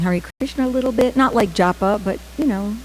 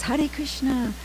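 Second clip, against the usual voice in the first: high, soft acted greeting; airy greeting; 7 semitones higher, 6 dB quieter, slower than usual. Daughter flies into the house in sari, airy greeting. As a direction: float it out high and lightly.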